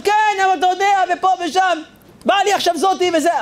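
high, put-upon excuse-making voice; whiny driver. Retold or imitated. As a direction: whine out the excuses. Driver makes excuses to Chaim. (נהג מתבכיין בקול גבוה)

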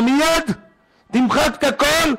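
high, drawn-out retold command; acted urgent order. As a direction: demand it at once, as in a story.

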